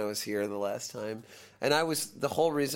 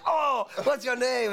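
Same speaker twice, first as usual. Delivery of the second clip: very high, drawn-out mock delight; gushing excitement; 12 semitones higher, 4 dB louder, slower than usual. Rock star asking the fan's name, gushing excitement. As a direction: imitate a star suddenly thrilled to meet you, slow and high. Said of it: "And he got so excited".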